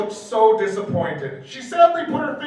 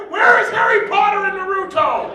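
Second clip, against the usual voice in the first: higher, louder exasperated yell; exasperated shout; 8 semitones higher, 4 dB louder than usual. Real, not acted. Fed up with the fanfic, an exasperated laughing shout. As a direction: demand it in disbelief. Outraged at the story.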